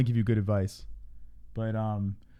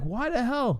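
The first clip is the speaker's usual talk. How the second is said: high incredulous exclamation; 12 semitones higher, slower than usual. Retold or imitated. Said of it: "And, like".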